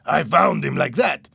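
loud protest. A narrator's reading. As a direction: protest loudly and defensively. Louis lies amid shouted confrontation.

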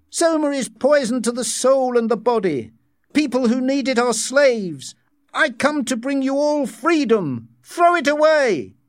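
high, loud impassioned oratory; shouting. A narrator's reading. John urging the crowd to freedom, shouting. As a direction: rouse a crowd at full voice. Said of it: "John shouted".

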